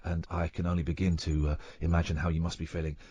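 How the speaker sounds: soft, low, stammering English apology; imitation of a bumbling, deeply apologetic English gentleman; Hugh Grant. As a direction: apologise profusely in a hushed, halting posh voice. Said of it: "I did my very best Hugh Grant verbally".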